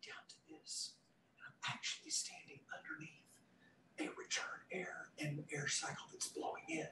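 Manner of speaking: hushed, breathy whisper. Shown as a demonstration. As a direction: drop to a whisper to test the microphones. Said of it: "take the volume of my voice".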